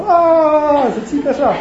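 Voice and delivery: high, loud drawn-out "A" cry; mock scream. Storyteller showing how to scream, high mock scream. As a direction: demonstrate a scream in jest.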